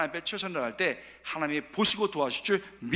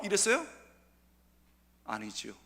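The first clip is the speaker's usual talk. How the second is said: ordinary talk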